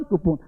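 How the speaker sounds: कूपन with short "pun" ending; wrong pronunciation. Shown as a demonstration. Teacher shows what not to say, mispronounced. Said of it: "नहीं बोलना".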